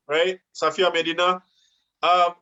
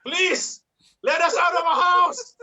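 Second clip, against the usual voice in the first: mock plea; 8 semitones higher than usual. Father to politicians, mock desperate shouted plea. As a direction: beg to be let out, hammed up.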